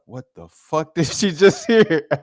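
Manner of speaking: a bystander who can't believe her ears; shocked lady. Retold or imitated. Lady in the aisle reacting to the kid, shocked.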